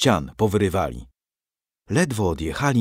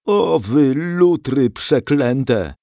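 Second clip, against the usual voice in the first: slow, drawn-out, louder curse; muttered curse; 4 semitones higher, 5 dB louder, much slower than usual. A narrator's reading. Grandfather thrown on the floor curses the Swedes, grumbling slowly. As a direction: grumble it bitterly, dragging the words. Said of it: "mruczał pod nosem".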